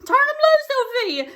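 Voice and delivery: very high, loud distant yell; daddy yelling after his daughter, loud and high. Retold or imitated. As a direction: shout it from far behind. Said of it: "my daddy in the background yelling".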